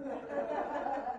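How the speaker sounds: a short disbelieving laugh at the quote; scoffing laugh. Real, not acted. Preacher laughs at a writer's claim, scoffing. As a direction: laugh it off as absurd.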